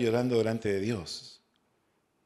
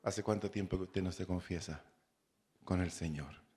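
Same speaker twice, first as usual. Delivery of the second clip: asked much softer and lower, gently probing; quiet solemn question; 6 semitones lower, 10 dB quieter than usual. Retold or imitated. Preacher asking the congregation about confession, softly and low. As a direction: lower the voice to a hushed, searching question.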